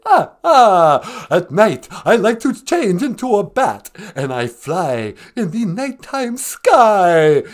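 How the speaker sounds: put-on loud, theatrical vampire count with an accent; Count Von Count. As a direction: ham up the counting vampire, rolling "ah, ah". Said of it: "the Count".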